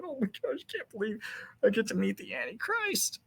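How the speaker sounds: high, soft, hammed-up swooning voice; mock starstruck admirer. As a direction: coo like a tearful fan meeting a star. Mocking crying admirer.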